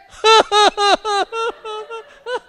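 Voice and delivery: loud, high wail that sounds like laughing; put-on cry-laugh; fake crying laugh. Shown as a demonstration. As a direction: pretend to bawl in ha-ha bursts. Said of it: "Between laughing and crying".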